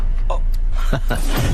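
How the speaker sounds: gloating laugh. Acted. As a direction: laugh out over the boast. Villain laughs while boasting of bribe. (घमंड भरी हँसी)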